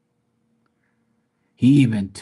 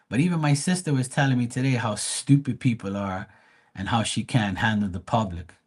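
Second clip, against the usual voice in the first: usual speaking voice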